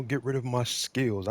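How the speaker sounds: ordinary talk, nothing marked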